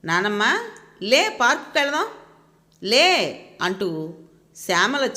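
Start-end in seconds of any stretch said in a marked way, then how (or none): 0.0-3.5 put-on higher, pleading little boy's call; a small boy tugging his granny awake, calling her to the park; child pestering grandma.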